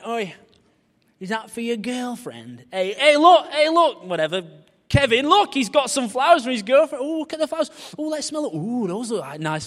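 loud, high jeering lads; mocking lads. Lads teasing the preacher about his flowers, loud jeering. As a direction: ham up teasing youths. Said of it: "these guys were like".

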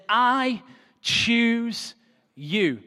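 lifted, drawn-out I choose you; solemn declaration. Acted. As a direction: proclaim it slowly like a blessing. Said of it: "intercepts his life and says".

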